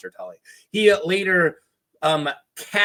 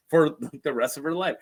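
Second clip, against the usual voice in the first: ordinary talk, nothing marked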